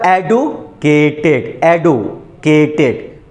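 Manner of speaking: एडुकेटेड split slowly as एडु, pause, केटेड; broken into syllables. Shown as a demonstration. Teacher demonstrating the break to class, slow and split. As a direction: break एडुकेटेड in two with a clear gap. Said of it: "दो बार में break हो रहा है ना".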